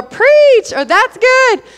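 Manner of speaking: high, loud call-out of Preach; congregation call-out. Retold or imitated. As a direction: shout it back like an amen corner. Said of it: "if you say".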